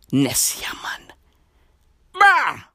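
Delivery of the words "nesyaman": breathy hushed whisper of the name; hushed, almost voiceless. Real, not acted. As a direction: whisper it like a secret. Awed repeat of the mummy's name.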